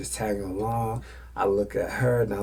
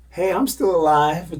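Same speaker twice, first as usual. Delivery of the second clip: higher, louder plea for attention; attention-seeking shout; 9 semitones higher, 10 dB louder than usual. Retold or imitated. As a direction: yell to be noticed. Friend demands attention.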